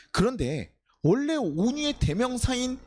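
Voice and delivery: ordinary talk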